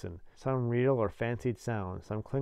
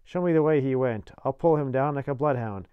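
louder, vengeful demand; fierce convict; 3 semitones higher, 7 dB louder than usual. A narrator's reading. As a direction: vow to hunt him down. Convict vows to hunt man down.

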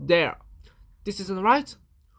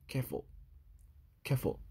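lower, soft warning; soft caution; 6 semitones lower, 11 dB quieter, much faster than usual. Acted. Louis warning softly while the page is rubbed. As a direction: ease off gently.

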